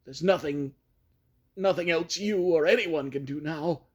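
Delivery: high, loud desperate scream; scream of despair. Acted. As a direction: scream at him to stop and run. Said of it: "changed to a scream of utter despair".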